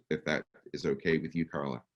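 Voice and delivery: normal talking voice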